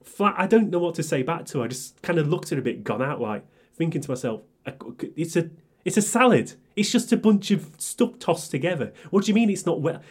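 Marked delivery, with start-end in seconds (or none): 4.6-10.0 exasperated disbelief. Retold or imitated. Exasperated inner thought.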